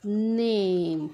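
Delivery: नेम drawn out very long and clear; stretched teaching word. Shown as a demonstration. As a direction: hold the vowel in नेम like a teacher. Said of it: "हे जो उदाहरण काय आहे बघा?". (नेम शब्द हळू, ताणून उच्चारा)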